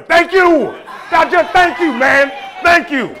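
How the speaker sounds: loud, high exaggerated thank you; over-the-top yelled gratitude; mock shout of thanks. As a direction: shout your thanks at the crowd. Comic yelling thanks at crowd.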